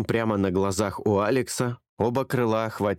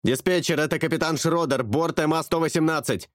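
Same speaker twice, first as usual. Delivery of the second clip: higher pilot through static; distorted radio voice; 7 semitones higher than usual. A narrator's reading. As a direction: speak through crackling radio. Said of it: "искажённый помехами". (голос пилота, искажённый помехами)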